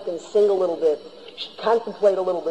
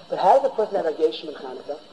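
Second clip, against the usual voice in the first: normal talking voice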